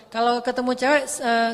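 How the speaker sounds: ordinary delivery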